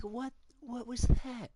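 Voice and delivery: slow, high, drawn-out what; stunned disbelief. Real, not acted. Gamer replaying a baffling moment, slow stunned disbelief. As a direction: stretch it out in disbelief.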